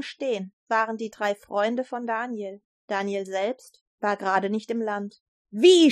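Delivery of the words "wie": loud, higher outraged cry; king's shout. A narrator's reading. King sees three men standing, shouts in outrage. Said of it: "schrie der König".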